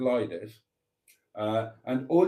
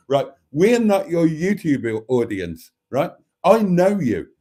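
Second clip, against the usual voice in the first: louder, incredulous teasing; mock outrage; 4 semitones higher, 8 dB louder than usual. Real, not acted. Vlogger teases a friend's cold cottage claim, loud and incredulous. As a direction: call out his bluff with a laugh in the voice.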